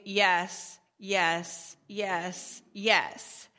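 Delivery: low, automatic agreement; zoned-out acted yeses; mindless yes. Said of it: "falling into this mindless headspace".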